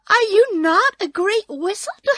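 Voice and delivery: put-on high, loud, astonished girl; crying out. A narrator's reading. Dorothy challenging the little man, crying out. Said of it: "cried Dorothy".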